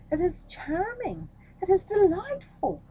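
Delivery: rising to a high, loud gush; gushing praise. Acted.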